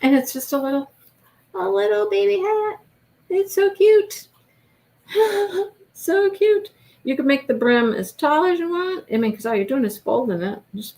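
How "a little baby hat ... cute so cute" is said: cooing. Real, not acted. Crafter cooing over the tiny baby hat.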